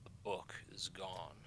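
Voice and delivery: whispered, fading report to his lord; hushed elf report. Acted. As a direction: breathe the bad news to your master. The elf says.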